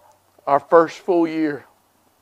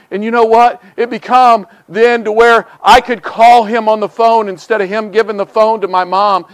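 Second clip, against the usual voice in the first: ordinary talk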